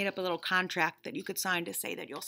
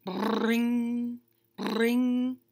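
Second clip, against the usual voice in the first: phone ring imitation. Phone ringing sound.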